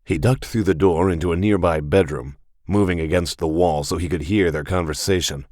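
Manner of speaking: usual speaking voice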